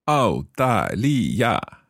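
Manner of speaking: very slow, syllable by syllable; slow learner's repeat. Shown as a demonstration. Learner repeating the teacher's Chinese phrase, very slowly. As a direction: draw out each tone carefully. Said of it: "saying each of these phrases quite slowly".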